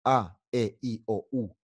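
A, E, I, O, U as open Spanish vowels; pure, wide-open Spanish vowel names. Shown as a demonstration. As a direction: recite "A, E, I, O, U" ah, eh, ee, oh, oo. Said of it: "En español es U".

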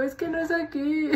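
whiny imitation of her own crying; lightly hammed-up tearful protest; mock tearful. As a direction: playfully plead like a crybaby. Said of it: "llorando porque soy bien chillona".

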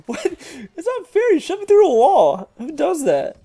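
high-pitched disbelieving protest; indignant squeal. Real, not acted. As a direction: squeal in outrage at the unfair move. Protesting being shoved in the game.

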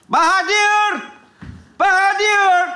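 high, drawn-out summons; stretched, high-pitched name call. Acted. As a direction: call the janitor from afar. Calling for the school janitor.

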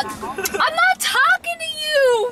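high, drawn-out squawk of protest; comic outburst at a butting-in gadget; exasperated yell. Real, not acted. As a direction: scold the interrupting phone in a high, stretched voice. Scolds phone assistant that interrupts.

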